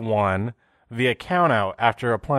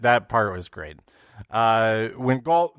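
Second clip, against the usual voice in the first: ordinary delivery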